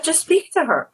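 high-pitched imitation of advisers; lightly exasperated high quote; insistent mock advice. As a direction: mimic their urging way up high. Acted repeat of their advice.

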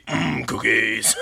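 low, slow, drawn-out savoring; softly mock a cookie-loving toy voice; imitating talking belly button. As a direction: mimic the deep button voice slowly. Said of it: "and you're just gonna be".

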